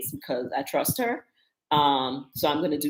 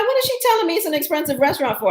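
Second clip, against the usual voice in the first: much higher, fast, exasperated rant; indignant disbelief; 11 semitones higher, 5 dB louder, faster than usual. Retold or imitated. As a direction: fire off the question in outraged disbelief. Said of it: "But I was like".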